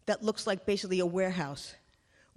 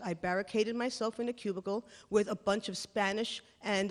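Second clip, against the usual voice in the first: usual speaking voice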